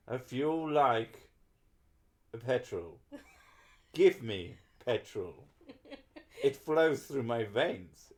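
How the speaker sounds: dramatic storyteller in a mock German accent; story reading. Streamer reading song lyrics as a story, theatrical storytelling. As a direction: stretch the phrases out, lifting key words like a tale. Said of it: "needs to be read as a story".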